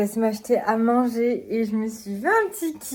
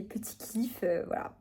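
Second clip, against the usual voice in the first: whispering; 9 dB quieter than usual. Real, not acted. Confiding a small treat.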